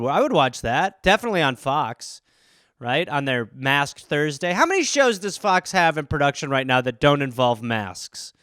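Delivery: ordinary talk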